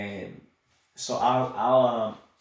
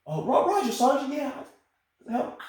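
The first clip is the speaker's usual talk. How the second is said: high, hopeful private's reply, a bit louder; eager new private; 5 dB louder than usual. Retold or imitated. New private thanking his sergeant, high and eager. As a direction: grab at the offered help gratefully. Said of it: "the private accepting the offer".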